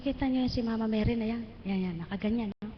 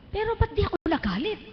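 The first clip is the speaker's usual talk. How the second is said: much higher, louder wonder; amazed; 10 semitones higher, 4 dB louder than usual. Real, not acted. Asking herself why she felt no anger, amazed and high. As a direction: rise up in disbelief.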